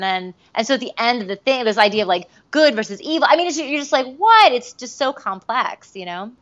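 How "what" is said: high, loud stunned yelp; a sudden disbelieving what; shocked what. Retold or imitated. Quoted shock.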